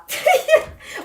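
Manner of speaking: high burst of laughter. Real, not acted. Reader catching her own misreading, high laugh. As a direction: laugh out at your own slip.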